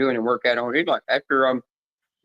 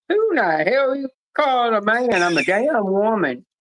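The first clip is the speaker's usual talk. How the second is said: mock outraged, pitched up and slowing on the last words; retold indignant snap at a shop lady; indignant retort; 8 semitones higher than usual. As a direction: fire back at the stranger, half-acted offence. Retold comeback to a stranger.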